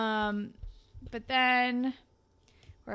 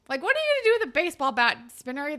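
fast, high, louder disbelief; exasperated scolding; 5 semitones higher, 6 dB louder, much faster than usual. Real, not acted. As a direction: scold him for being a dummy. Teller scolding a character.

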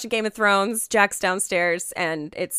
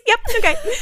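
high chirpy yep; 12 semitones higher, 6 dB louder than usual. Retold or imitated. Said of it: "I was like".

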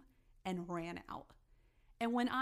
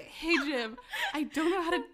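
nervous laugh running through the words, higher and louder; 7 semitones higher, 4 dB louder than usual. Retold or imitated. Telling her boss Jim about the mess, laughing nervously. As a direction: giggle through the bad news. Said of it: "exactly what I say".

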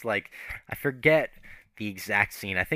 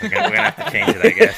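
laughing resignation; 8 dB louder, much faster than usual. Retold or imitated. Crew guy caving on the shot, fast and laughing. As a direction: give in with a helpless laugh. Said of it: "And he's like".

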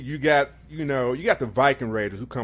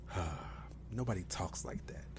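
muttered disbelief; 5 semitones lower, 15 dB quieter than usual. Retold or imitated. Muttered reaction to the voice.

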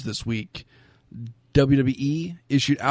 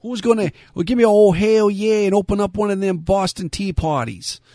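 put-on Boston accent; 8 semitones higher, 7 dB louder than usual. Joking Boston accent continues.

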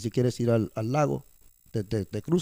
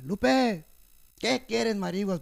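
high-pitched calling out and answering; acted exchange with Lupe; 7 semitones higher, slower than usual. Worker re-enacting calling his co-worker Lupe, high voice. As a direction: raise the pitch sharply for a retold call and reply. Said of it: "ahí vamos con la señora Lupe".

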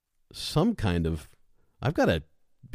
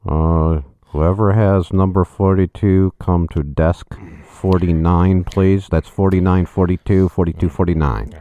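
louder, slow bored clerk voice; DMV clerk; 11 dB louder, slower than usual. Pretend. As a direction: call numbers like a tired clerk. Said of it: "you gotta be the person from the D M V".

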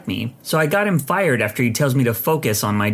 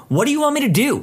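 mock yell, pitched far up; imagined yell; 11 semitones higher, faster than usual. Clerk imagining yelling back at the customer. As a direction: let the frustration burst out in a half-yell. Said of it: "I feel like yelling at her".

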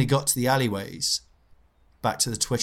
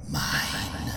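low, eerie "Mine" of a ghost woman; ghostly old woman; 6 semitones lower than usual. Retold or imitated. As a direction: drop the voice for a ghostly claim. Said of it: "pointed straight at him and went".

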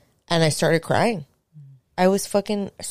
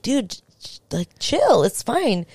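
high-pitched, playful brush-off; teasing, higher-voiced reassurance; playful chiding; 7 semitones higher, slower than usual. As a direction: retell telling him lightly to relax. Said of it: "I'm being playful".

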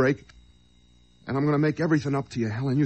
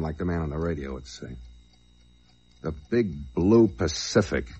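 low, quiet noir narration; noir detective; 5 semitones lower, 4 dB quieter than usual. Acted. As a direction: brood over the memory quietly. Quiet hard-boiled flashback narration.

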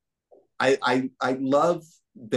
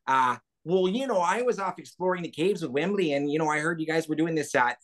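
put-on high, bright-eyed young Fraggle chatter; Gobo voice; 5 semitones higher than usual. Gobo answering the podcast hosts' request, high and cheerful. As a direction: play a cheery, curious puppet adventurer. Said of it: "is it fine if we can hear a bit of Gobo?".

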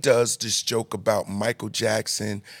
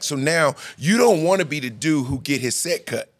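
usual speaking voice

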